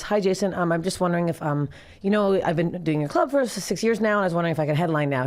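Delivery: ordinary delivery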